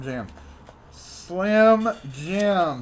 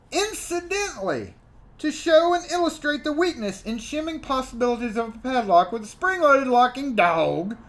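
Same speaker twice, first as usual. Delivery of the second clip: formal letter read with raised voice; reading a letter aloud; 6 semitones higher, 5 dB louder than usual. Acted. Vlogger reading a lock maker's complaint letter, formal and indignant. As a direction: read it out like an indignant company manager.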